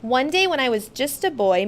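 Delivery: usual speaking voice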